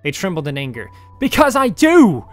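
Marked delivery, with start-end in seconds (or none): 1.2-2.2 high, loud, slow booming cry; booming thunder. Acted. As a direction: let it boom through the room.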